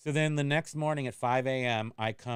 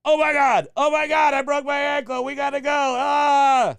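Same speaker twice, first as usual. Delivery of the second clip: high, loud panicked screaming; panicked yelling; 14 semitones higher, 12 dB louder than usual. Retold or imitated. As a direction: scream that the ankle is broken. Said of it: "very much what it sounded like".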